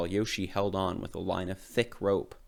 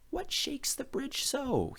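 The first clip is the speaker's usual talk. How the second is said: in fear; 4 dB quieter, slower than usual. A narrator's reading. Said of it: "he asked in fear".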